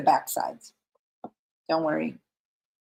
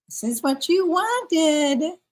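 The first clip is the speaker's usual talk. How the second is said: mock husband line, much higher and slow; quoting husband; 9 semitones higher, slower than usual. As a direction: play the husband teasing her. Quotes husband coming home.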